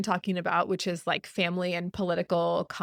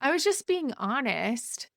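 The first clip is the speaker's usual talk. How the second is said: higher, slow, self-righteous mock; defensive excuse; 5 semitones higher than usual. Mocking a partner who blames honesty, smug. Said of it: "fake apologies".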